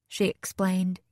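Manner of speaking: ordinary delivery, nothing marked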